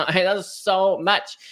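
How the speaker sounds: amused, emphatic repeat; laughing emphasis. Real, not acted. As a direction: laugh it out and lean on so.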